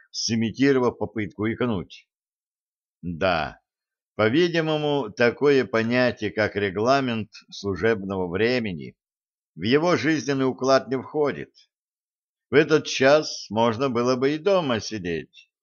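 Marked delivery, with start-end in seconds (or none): none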